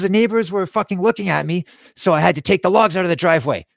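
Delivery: high-pitched, agitated imitation of dad; heated self-defense. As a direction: protest indignantly as the cornered dad. Retold argument, dad defending himself.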